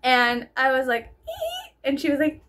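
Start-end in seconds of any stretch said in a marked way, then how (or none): 1.3-1.7 tiny high-pitched squeak, softer; a little mock squeak of confusion. Speaker retelling her reaction with a squeak.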